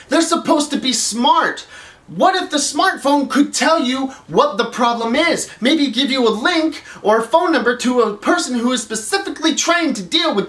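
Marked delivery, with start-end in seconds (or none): none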